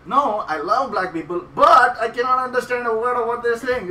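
high, loud insistence to a heckler; loud retold protest.

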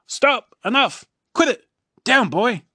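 high, loud shouting at the dog; chief yelling. A narrator's reading. Chief shouting at Dog Man licking him, high and loud. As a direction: yell stop at a jumping dog. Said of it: "says the chief".

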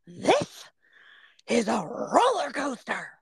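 put-on indignant little-kid voice, starting high and drawn out; Savannah as toddler. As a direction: mimic an outraged three-year-old, word by slow word. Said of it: "how little kids talk like that".

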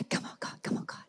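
urgent breathless whisper, pleading with God. Retold or imitated. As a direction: beg under your breath. Praying hard for the prophet.

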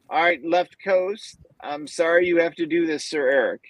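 usual speaking voice

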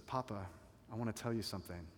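soft, tender plea to her father. A narrator's reading. Daughter gently tells her weeping father, soft and tender. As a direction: speak it gently, like to a grieving parent.

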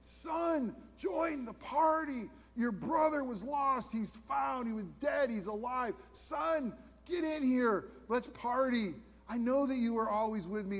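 high, slow, tender pleading; pleading father. Retold or imitated. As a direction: coax the son gently to come in. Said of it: "he pleads with him".

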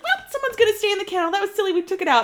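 high, quick mom voice. Retold or imitated. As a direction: rattle it off like a flustered mom. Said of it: "she's like".

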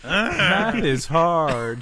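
very slow, high, loud doll voice; pull string toy. Pretend. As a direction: drag out each word like a talking toy.

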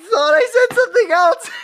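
high shrieking laughter through the words; cracking up, barely getting it out; laughing hard. Real, not acted. Laughing at Siri's mishearing, shrieking with laughter.

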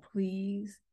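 soft, slowed plea; retold soft plea. Mother pleading with yelling daughter, soft and slow. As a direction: plead gently and quietly. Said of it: "I said".

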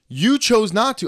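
high, loud retold accusation; heated retort. Retelling his comeback to the driver, heated.

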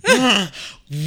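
loud dramatic gasp; shocked gasp. Real, not acted. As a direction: gasp in outrage. Shocked reaction to opinion.

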